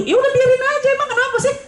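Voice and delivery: mock-exasperated shrug; exasperated advice. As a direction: pitch it way up, baffled that anyone would fuss. Advising husband to let it go.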